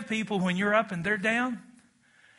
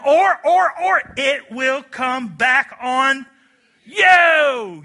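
loud, high, slow warning; forceful preaching; emphatic warning; 6 semitones higher, 11 dB louder, much slower than usual. Real, not acted. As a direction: drive the point home slowly.

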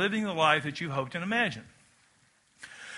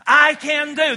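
"I can do" called out higher and louder; loud declaration; 5 semitones higher, 5 dB louder, slower than usual. Shown as a demonstration. Preacher repeating the chant, loud. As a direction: lift it up with full voice. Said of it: "One more time".

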